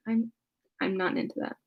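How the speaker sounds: usual speaking voice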